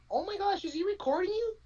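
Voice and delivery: high-pitched imitation of a shocked onlooker; mock shocked girl. Girl at self-checkout spots someone filming, mock shocked. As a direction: put on a high, scandalized girl's voice.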